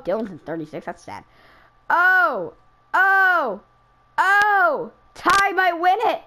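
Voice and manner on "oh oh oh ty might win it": three rising loud oh's, then slow and high; rising excitement. Real, not acted. Racer watching a friend about to win, mounting excited cries. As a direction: build the oh's up, each higher.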